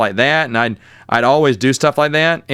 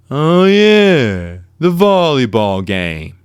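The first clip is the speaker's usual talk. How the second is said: mock taunt; much slower than usual. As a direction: needle him with slow, mocking recognition. Rival's taunt acted out in retelling.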